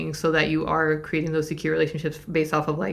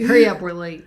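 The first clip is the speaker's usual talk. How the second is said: laughing mock impatience; 9 dB louder than usual. Acted partner brushing off bid.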